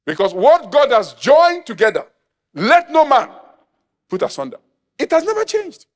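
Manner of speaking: real pulpit declamation; forceful preaching. Real, not acted. Preaches scripture at full force.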